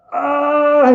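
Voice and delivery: long, high, loud wail; imitated child's yell. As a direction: mimic a hurt kid's drawn-out yell. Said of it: "he started yelling".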